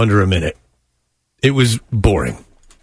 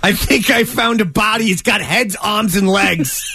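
higher-pitched hammed-up Boston caller; mock Boston accent; 5 semitones higher than usual. Mocking the reenacted emergency caller, hammed-up Boston accent. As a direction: parody a bad Boston accent. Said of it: "to do a Boston accent".

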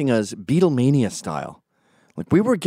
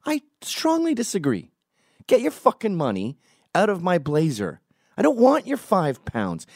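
indignant rant; 9 semitones higher than usual. Real, not acted. To Ezra, refusing his money, in high outraged indignation. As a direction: spit out the insult of being paid back. Ranting at Ezra's five pounds.

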